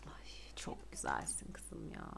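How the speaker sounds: breathy admiring whisper; awed whisper to the pretty character. Real, not acted. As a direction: whisper it in awe.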